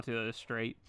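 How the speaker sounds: usual speaking voice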